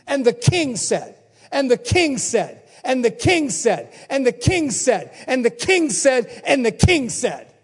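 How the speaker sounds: loud chant, stress on king; chanted refrain; rhythmic repetition. Acted. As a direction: hammer each "king" the same way. Repeating seven times rhythmically.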